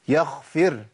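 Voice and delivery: يغفر said with kh for gh; common mistake. Shown as a demonstration. Teacher repeating what is often heard, deliberately mispronounced. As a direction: let breath turn the ghayn in يغفر to kh. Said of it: "بنسمع كتير".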